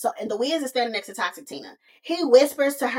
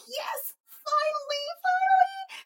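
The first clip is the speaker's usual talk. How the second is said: high, thrilled squeal of finally; hyped excitement; 5 dB quieter, slower than usual. Retold or imitated. Toxic Tina celebrates the spell working, high and thrilled. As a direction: squeal it out, overjoyed. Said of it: "She's all hype and excited".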